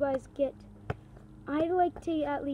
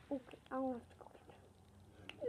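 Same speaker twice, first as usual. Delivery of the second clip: whispering; 19 dB quieter than usual. Real, not acted. Aside while chasing ball.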